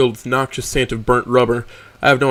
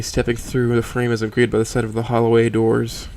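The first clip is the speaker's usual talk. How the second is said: plain reading voice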